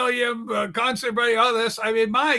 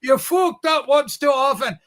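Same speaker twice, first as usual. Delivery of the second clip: ranting in a put-on Scottish accent; comic Scottish-accented tirade. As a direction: deliver a mock-angry rant in Scottish. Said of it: "You're doing Scottish".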